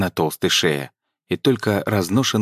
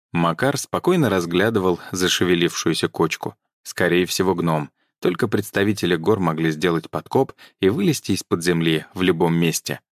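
ordinary delivery, nothing marked

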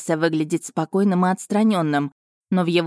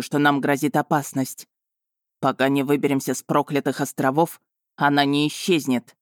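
lightly voiced dragon. A narrator's reading. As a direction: play the dragon steady and sure. Dragon Vargan voiced by narrator.

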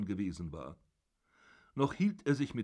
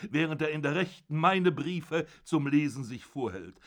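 put-on loud, raised furious old father berating his son; zorniger alter Vater; 4 semitones higher, 4 dB louder than usual. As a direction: bellow down at your son from the bed. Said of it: "father gloats over Georg". (wütender Vater, laut und erhoben)

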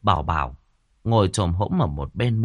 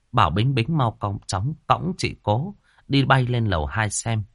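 ordinary delivery

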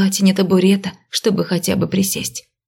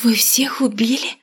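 strangled, whispered in horror; choked with horror; 4 semitones higher than usual. Acted. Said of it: "сдавленным голосом спросила я в ужасе".

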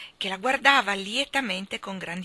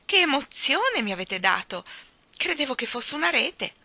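high, louder flustered complaint; agitated after a mild fright; terrified; 7 semitones higher, 5 dB louder than usual. A narrator's reading. Cod mistook hair for a net, terrified. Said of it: "gridò una voce atterrita".

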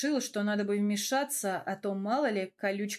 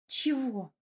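higher, mildly grumpy question; grumbling; 4 semitones higher than usual. A narrator's reading. Велора answers her lover, grumbling. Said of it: "проворчала Велора".

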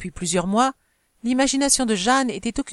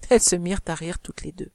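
usual speaking voice

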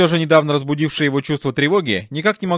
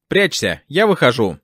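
usual speaking voice

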